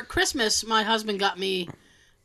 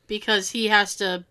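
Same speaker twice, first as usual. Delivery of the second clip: normal talking voice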